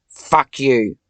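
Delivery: loud, snarled curse; furious shout. Real, not acted. Cursing Satan out of her head, furious shout. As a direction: spit it out in rage.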